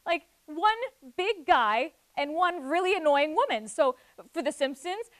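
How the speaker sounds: normal talking voice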